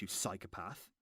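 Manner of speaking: normal talking voice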